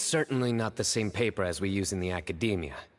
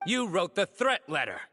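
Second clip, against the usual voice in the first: high, raised-voice accusation; forceful accusation; 11 semitones higher than usual. Acted. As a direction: confront the culprit, pitch well up. Accuses the caught culprit.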